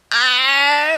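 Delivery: high startled squawk; squawking cry. Acted. Chickens squawk, shown in voice.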